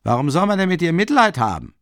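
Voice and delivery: high, loud jeering shout; innkeeper's shout. A narrator's reading. Innkeeper mocks Marmeladov, a high, loud jeering shout. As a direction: bellow the jeer across the room. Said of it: "rief der Wirt".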